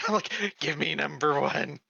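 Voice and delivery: ordinary delivery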